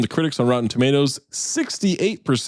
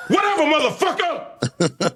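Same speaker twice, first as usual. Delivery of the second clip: laughing. Real, not acted. Laughing high outburst.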